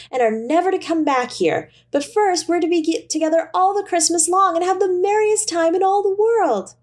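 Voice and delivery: put-on high, eager child's voice; little girl. A narrator's reading. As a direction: play the little sister bursting with joy. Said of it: "said the child".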